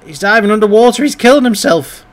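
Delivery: high, loud outburst of alarm; alarmed exclamation. Real, not acted. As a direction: cry out in alarm at the danger. Alarmed at game child underwater.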